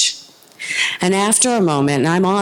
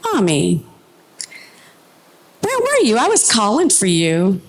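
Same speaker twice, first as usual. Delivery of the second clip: put-on child voice; 3 semitones higher than usual. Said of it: "he looks up and says".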